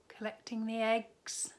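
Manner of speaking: plain reading voice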